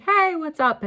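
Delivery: high, loud cheerful "Hey, what's up?"; bright upbeat greeting. Retold or imitated. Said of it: "I'm like".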